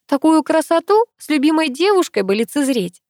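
high, needling tone; sarcastic. A narrator's reading. Girl glancing at Pasha about his girlfriend, sarcastic. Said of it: "язвительно сказала я".

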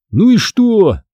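high-pitched irritated retort; snapping back. A narrator's reading. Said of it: "огрызнулся Кайл". (огрызаясь, высоким голосом)

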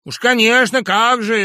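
high, loud mocking retort; ironic. Sharikov mocks the professor, ironic and loud. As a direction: sneer it sarcastically. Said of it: "иронически заговорил человек".

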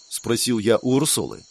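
usual speaking voice

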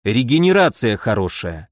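ordinary delivery

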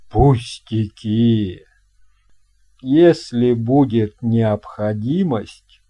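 put-on a hearty old captain welcoming a guest; captain's voice. Captain boasts of ship. (басовитый голос капитана)

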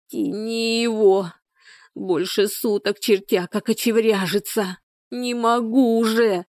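slow, stretched labouring plea; drawn-out wail. A narrator's reading. Exhausted woman giving birth, pleading, drawn-out wail. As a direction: wail it slowly, vowels held long. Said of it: "протяжным голосом завыла Людмила".

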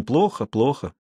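subdued, rueful repetition; sighing regret. A narrator's reading. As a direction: lament it with a mild sigh. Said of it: "сказал Жуков и вздохнул".

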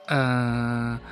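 usual speaking voice

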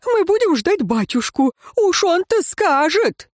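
put-on very high, gleeful girl's voice; high, radiant, naive excitement; beaming. A narrator's reading. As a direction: crow triumphantly about daddy, pitched high. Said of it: "вновь засияла Лейра".

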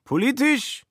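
put-on high, slurred drunken voice; drunk Schmitz. A narrator's reading. Drunk Schmitz questions Kobler in a high voice.

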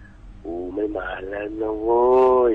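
slow, stretched-out imitation of a friend; drawn-out announcement. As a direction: drag out the final word like a call. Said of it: "ทำเสียงอย่างเงี้ย".

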